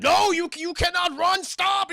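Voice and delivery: high, loud yell to stop; mock doctor yell. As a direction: shout after the runaway. Doctor yelling as she escapes.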